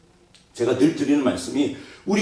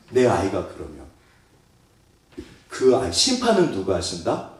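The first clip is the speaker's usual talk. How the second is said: ordinary talk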